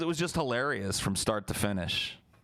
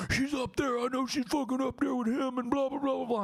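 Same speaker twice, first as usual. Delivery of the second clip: much higher squeaky threatening voice; mock angry friend. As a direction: squeak out the threat like a furious little guy. Yoshi ranting.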